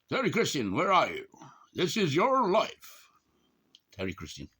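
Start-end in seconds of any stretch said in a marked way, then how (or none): 0.1-3.1 loud, high hammed-up call; playful shouted summons, rising in pitch; mock calling out. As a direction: holler it high, stretching the last words.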